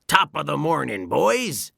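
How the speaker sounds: overly chipper greeting with a touch of nerves; nervous Mister Krabs. A narrator's reading. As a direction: play it as a nervous crab trying to sound casual. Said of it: "said Mister Krabs a little nervously".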